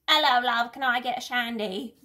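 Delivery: loud, hammed-up English pub-goer; bad English accent. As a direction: put on a poor English accent. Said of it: "don't have a great English accent".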